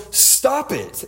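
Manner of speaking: high-pitched mock yell, no real volume; retold yell. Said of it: "he raises his voice and he yells".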